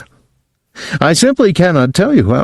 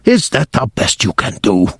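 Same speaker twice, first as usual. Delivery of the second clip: witch scorn; 2 semitones lower than usual. Acted. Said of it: "cried out".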